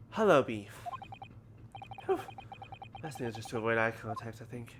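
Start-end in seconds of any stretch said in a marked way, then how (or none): none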